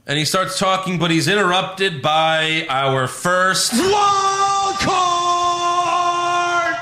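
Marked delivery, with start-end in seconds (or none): 2.7-6.8 big announcer build. Retold or imitated.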